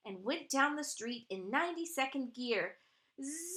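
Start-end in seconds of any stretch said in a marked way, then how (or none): 3.2-3.6 high, long rising zoom; zoom noise. Acted. Wheelchair races to the hospital, high long zoom. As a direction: whizz away on a stretched zoom. Narrator imitates chair racing off.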